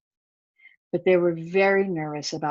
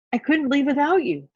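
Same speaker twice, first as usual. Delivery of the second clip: higher, louder, heartfelt duck voice; little duck voice; 8 semitones higher, 4 dB louder than usual. A narrator's reading. Little duck tells his alligator friend, heartfelt. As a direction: rush it out with feeling.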